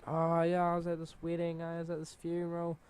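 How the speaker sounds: high, held pitch for mock excuses; making excuses. Kid feeding the teacher excuses, put-on high voice. Acting out his feeble excuses.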